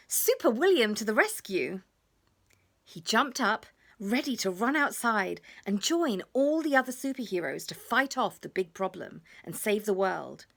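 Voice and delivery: ordinary delivery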